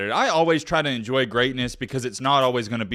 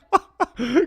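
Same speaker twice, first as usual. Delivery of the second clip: short high burst of laughter; amused laugh; 11 semitones higher than usual. Real, not acted. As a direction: laugh at the burn. Laughing at coach's reply.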